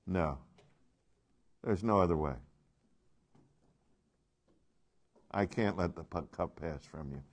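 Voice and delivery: low, soft and final; grave refusal. Acted. Father answering his Son's prayer, low quiet refusal. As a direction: answer softly, voice dropped low. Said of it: "What was the answer?".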